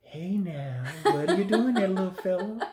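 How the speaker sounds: high, laughing, sing-song gentle; mock gentle voice. Father mocking the gentle way he was supposed to speak, sweet. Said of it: "I was supposed to be like".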